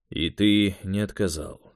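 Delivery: slow, level, no question in it; doubtful statement. A narrator's reading. Interrogator to young agent, doubtful without questioning intonation. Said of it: "с некоторым сомнением произнёс Юрий совершенно без вопросительных интонаций".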